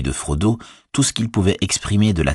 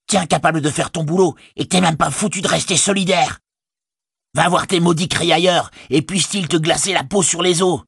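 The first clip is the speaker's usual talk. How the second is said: higher, raging orc tirade; furious orc rant; 9 semitones higher than usual. Acted. Orc tracker cursing the soldier before fleeing, raging. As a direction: keep hurling curses at him.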